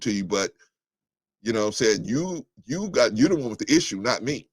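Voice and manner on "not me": ordinary talk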